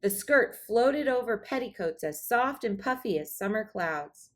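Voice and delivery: plain reading voice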